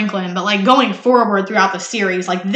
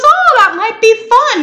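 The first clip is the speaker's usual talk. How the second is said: higher, bright-eyed mock enthusiasm; mock eager husband; 11 semitones higher, 4 dB louder than usual. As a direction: play him pushing to tag along, way up high. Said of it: "He goes".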